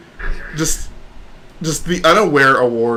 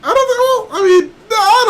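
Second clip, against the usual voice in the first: much higher doubtful "I don't know"; incredulous. Real, not acted. Doubting that Matt is the goody two-shoes, squeaky. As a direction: squeak out your disbelief.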